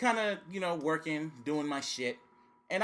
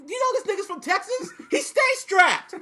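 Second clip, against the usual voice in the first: 1 excited warning; 12 semitones higher, 5 dB louder than usual. Retold or imitated. Retold warning about Stone Cold.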